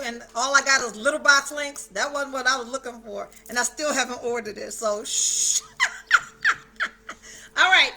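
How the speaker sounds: ordinary delivery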